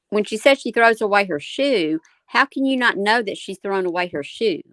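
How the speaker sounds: ordinary delivery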